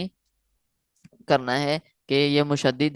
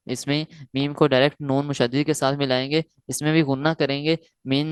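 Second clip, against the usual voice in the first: ordinary delivery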